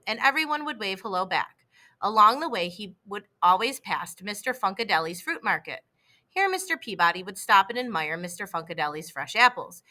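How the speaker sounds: plain reading voice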